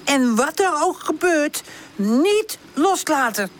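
high-voiced slow, firm warning; strict character voice, drawing out the command; stern. A narrator's reading. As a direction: spell out the order emphatically, with pauses. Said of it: "zet hij een strenge blik op".

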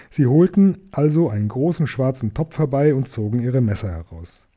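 usual speaking voice

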